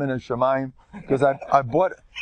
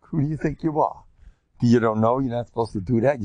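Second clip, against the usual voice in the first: ordinary talk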